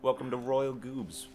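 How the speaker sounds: badly faked Italian welcome to viewers; horrible Italian accent. Shown as a demonstration. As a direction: attempt a cheesy Italian accent. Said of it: "my horrible Italian accent attempt".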